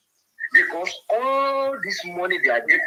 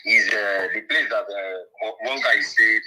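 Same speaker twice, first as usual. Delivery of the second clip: faint caller over a speakerphone; low, distant voice on a phone line; phone speaker; 6 semitones lower than usual. Real, not acted. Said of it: "Just make it audible more".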